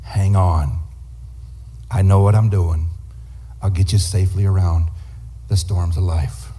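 calm assurance. Retold or imitated. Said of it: "When the captain says".

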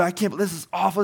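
furious customer; imitating the customer's outrage; angry complaint. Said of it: "And they're like".

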